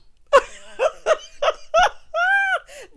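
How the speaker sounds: loud, high laughing fit; real helpless howl of laughter; shrieking cackle. Real, not acted. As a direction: lose it completely in squealing laughter. Cracks up at Dennis's Mm.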